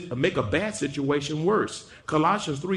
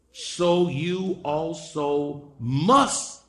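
"must" drawn out and landed hard; very slow build to a weighty "must"; stress on must; much slower than usual. Real, not acted. Preacher reads the command to forgive, leaning hard on "must".

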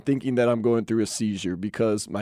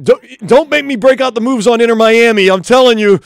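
loud, higher-pitched playful warning; mock threat; 6 semitones higher, 8 dB louder than usual. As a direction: threaten loudly to bust out the moves. Mock threat of ninja moves.

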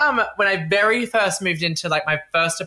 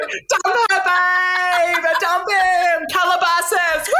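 mock party cheering; 12 semitones higher, much slower than usual. Retold drunk friend egging her on, in a high shouted cheer.

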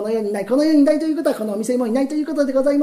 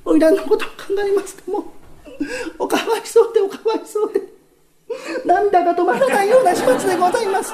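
声を高く上げて嘘泣きふうに; 涙声を芝居がかって作る; mock tearful; 8 semitones higher than usual. 喜助が泣いてみせる場面. (喜助が旦那に花魁の死を嘆いてみせる、嘘泣き)

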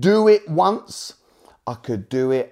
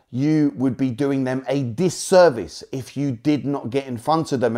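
usual speaking voice

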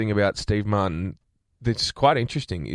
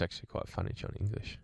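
breathy whisper, barely audible; whispered aside; 14 dB quieter than usual. Real, not acted. Host whispers the film title to his co-host. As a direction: drop to a private whisper of amusement.

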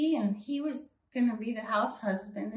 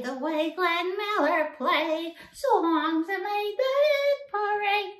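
high, slow off-key singing as Edith; Edith Bunker impression; 8 semitones higher, slower than usual. Shown as a demonstration. High slow sung Edith impression for Johnny. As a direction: warble it like Edith Bunker at the piano. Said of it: "Edith from All in the Family".

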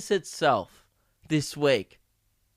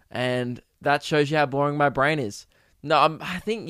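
ordinary talk, nothing marked

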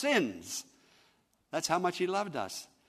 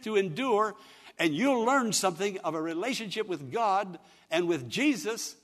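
loud, raised, urgent exhortation; full-force preaching; 6 semitones higher, 7 dB louder than usual. Real, not acted. Preacher urging a friend to take the test, forceful preaching. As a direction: drive it home to the congregation.

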